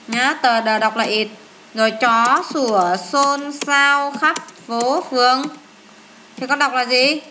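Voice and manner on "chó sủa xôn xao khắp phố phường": drawn out word by word; slow recitation. Shown as a demonstration. Teacher giving pupils a memory phrase, slow recitation. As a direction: recite it slowly, stretching the words.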